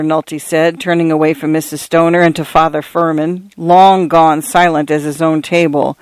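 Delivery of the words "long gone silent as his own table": usual speaking voice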